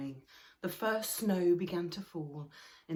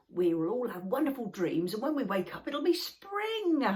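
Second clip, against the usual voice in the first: Snufkin reassuring; 6 semitones higher, 8 dB louder than usual. Acted. Snufkin comforting Moomin Troll at bedtime, bright and hopeful. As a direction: promise spring like a dreamy friend. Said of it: "said Snufkin".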